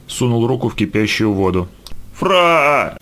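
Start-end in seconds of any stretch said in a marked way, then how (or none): 2.1-3.0 high, drawn-out cry of pain; inner scream. A narrator's reading. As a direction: cry out on one long vowel. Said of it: "закричало у него внутри". (протяжный крик боли)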